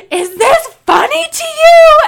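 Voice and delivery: hammed-up sick kid demanding an answer; mock angry kid. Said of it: "he looks at her all serious and he goes".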